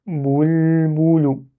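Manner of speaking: "Boul, boulou" joined through a held l; lips back on lam. Shown as a demonstration. Teacher modelling for mirror practice, "Boul, boulou" with a long l. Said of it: "si vous sortez les lèvres".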